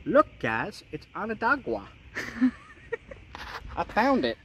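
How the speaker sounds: put-on Onondaga said with a Long Island accent, ending in a wah sound. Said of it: "with my Long Island accent".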